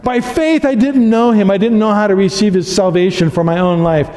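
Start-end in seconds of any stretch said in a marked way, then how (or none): none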